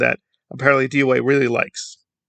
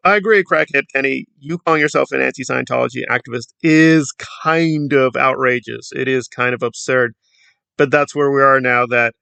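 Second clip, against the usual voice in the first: ordinary talk, nothing marked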